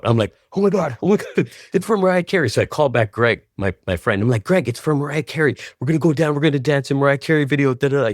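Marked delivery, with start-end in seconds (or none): 0.5-2.5 high, breathless excitement; freaking out. Retold or imitated. Telling himself the video is for [name], freaking out. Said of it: "I'm freaking out". 4.4-8.1 higher and fast, tumbling over the words; excited rush. Retold or imitated. Telling best friend [name] the news, excited and fast. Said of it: "He's freaking out".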